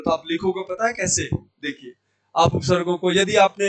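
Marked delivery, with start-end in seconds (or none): none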